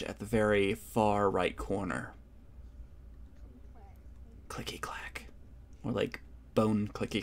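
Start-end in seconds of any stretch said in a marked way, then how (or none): 4.5-5.3 eerie whisper; creepy hushed sound effect. A narrator's reading.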